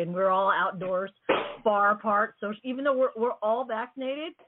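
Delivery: ordinary talk, nothing marked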